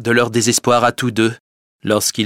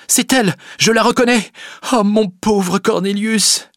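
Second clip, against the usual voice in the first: put-on higher, dismayed young girl; distressed Rosa; 12 semitones higher, 4 dB louder than usual. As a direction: cry out for Cornelius. Rosa recognises tulip.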